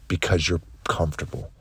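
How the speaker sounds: low, soft, quick drop; quiet verdict. Acted. Coach telling listeners why they lack answers, quiet verdict. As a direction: let the answer fall away, low and soft. Refrain dropped after each challenge.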